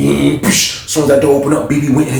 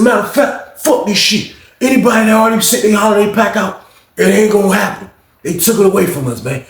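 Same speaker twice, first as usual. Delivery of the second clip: angry rant; 7 semitones higher than usual. Retold or imitated. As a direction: imitate the cheated man venting hard. Retelling angry Lawrence's announcement.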